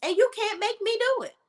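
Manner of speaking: high-pitched, sassy brush-off of a pushy suitor; a woman telling the suitor off, high and sassy; sassy comeback. Retold or imitated. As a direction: pitch way up and tell him off with attitude. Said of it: "Girl, I would have been like".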